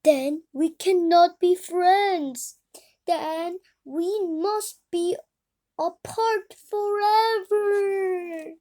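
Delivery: milk the tragic parting; dramatic sorrow. Acted. Gerald telling Piggy they must part, mock sobbing.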